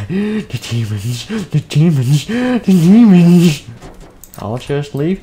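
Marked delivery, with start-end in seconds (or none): 0.1-3.6 slow chant building higher and louder; crazed, stretched-out cry; demon-obsessed raving. Acted. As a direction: draw it out, climbing into a wail. Character raving about demons.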